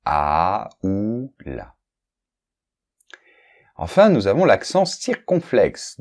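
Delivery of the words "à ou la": « à ou la » détaché mot par mot, très lentement; exemple lent. Shown as a demonstration. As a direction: posez chaque petit mot « à », « ou », « la » séparément. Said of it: "par exemple". (lecture lente d'exemples de professeure)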